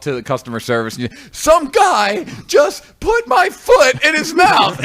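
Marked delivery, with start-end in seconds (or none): 1.3-4.8 high, slow outraged yell; angry shout. Retold or imitated. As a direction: shout it as a furious customer. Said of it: "you're all angry and you go up".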